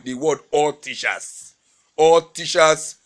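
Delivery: ALL TEACHERS drawn out slowly, word by word; underlining ALL TEACHERS. Real, not acted. Speaker underlining the words for a listener, slow stress on ALL TEACHERS. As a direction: stretch out ALL TEACHERS for emphasis. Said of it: "all unquote underlined".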